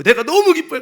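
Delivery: ordinary delivery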